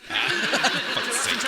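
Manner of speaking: echoing a curse through laughter; laughing. Retold or imitated. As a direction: repeat it while cracking up. Repeats contestant's curse laughing.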